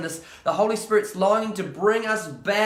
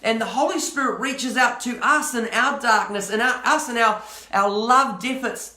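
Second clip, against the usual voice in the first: impassioned preaching; 3 semitones higher than usual. Acted. Preaching builds to full force.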